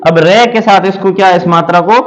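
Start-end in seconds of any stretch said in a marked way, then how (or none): none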